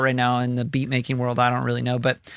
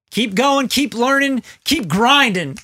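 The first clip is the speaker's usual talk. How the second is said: hyped up; 9 semitones higher, 5 dB louder, slower than usual. Acted. Ironic hyped sign-off.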